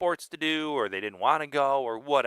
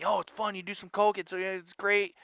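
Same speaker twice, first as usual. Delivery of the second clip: cokehead voice; 8 semitones higher than usual. Retold or imitated. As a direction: gush like a hyped-up party guy. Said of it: "they all say".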